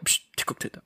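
hushing cinema whisper; furtive mock-cinema whisper; pretend whispered warning. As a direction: shush your friend under your breath.

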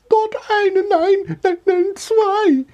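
very high, panicky squeal; woman screaming back. A narrator's reading. Woman pointing out two mice, screaming. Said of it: "schrie die Frau zurück".

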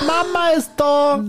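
put-on high, slow child voice; squeaky kids cheering; high slow childish cheer; little goats. Goats cheering mother's return.

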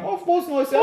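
high sing-song happy new year; mocking cheerful callers wishing happy new year, high; mock cheery greeting.

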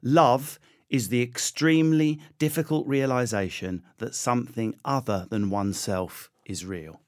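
slow, measured quote reading; slow recitation. Real, not acted. Guest presenting his favourite quote, slow and measured. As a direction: recite it deliberately, letting each phrase land.

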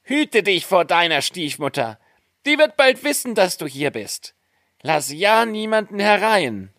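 high, urgent little dwarf voice; dwarfs warning. A narrator's reading. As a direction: caution her in piping tones. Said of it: "warnten es die guten Zwerglein".

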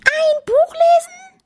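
piercing, slow penguin squeal; shrieked disbelief. A narrator's reading. Penguin appalled that Bella would read, shrieking. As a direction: shriek the question, very high and loud. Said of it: "schrie Maurice".

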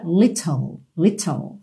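British "little" with a clearly released T; British pronunciation. Shown as a demonstration. Teacher demonstrating the British version, "little" with a true T. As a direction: say "little" the British way, T fully sounded. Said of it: "Se fosse uma pronúncia britânica".

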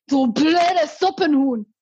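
slow, drawn-out put-on voice; mock insult. Imitating the man calling me a name. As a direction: drag out the insult slowly. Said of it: "Und der so".

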